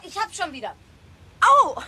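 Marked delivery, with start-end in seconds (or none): 1.4-1.8 sharp, loud yelp of pain; sudden ouch. Acted. Boy pinched by the toy car yelps.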